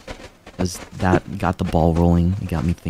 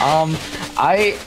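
giddy; 10 semitones higher, slower than usual. Real, not acted. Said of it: "what it's like to get, like, piss drunk".

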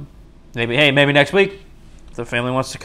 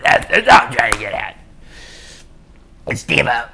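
rough, noisy put-on voice; hammed-up impression in a rasping voice; Steve-O; 2 semitones lower than usual. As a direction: do a bad celebrity impression. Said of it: "my shitty Steve-O impression".

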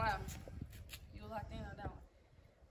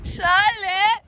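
calling out; 6 semitones higher, much slower than usual. Real, not acted. Calling out to someone on beach.

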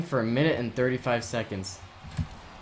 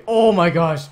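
loud, higher gamer exclamation; excited outburst; 5 semitones higher, 10 dB louder, slower than usual. Real, not acted. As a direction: blurt it out loudly in shock. Startled by play in game.

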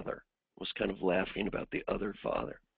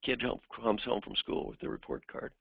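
ordinary talk

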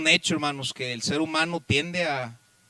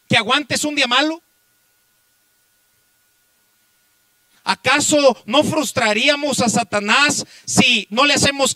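loud, raised pulpit voice; impassioned preacher's shout; full-force preaching; 8 semitones higher, 12 dB louder than usual. Real, not acted. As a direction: drive each phrase out to the congregation with force.

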